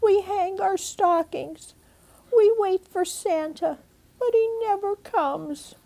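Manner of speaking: put-on high, small sad child voice; a little girl explaining gently, voice up high; little chipmunk girl. Nina telling her aunt Santa never comes, high and small. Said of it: "Little Nina sadly explained".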